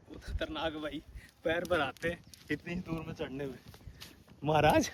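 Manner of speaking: real panting from the climb; winded, puffing between phrases; out of breath. Real, not acted. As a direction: talk softly while catching your breath. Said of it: "आपकी सांस से हांफने लग गए".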